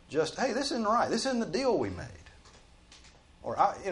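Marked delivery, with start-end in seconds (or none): 0.4-2.1 high, raised-voice objection; indignant mock complaint; acted protest. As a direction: push back at an unfair boss, pitched up and loud. Acts out objecting to a boss.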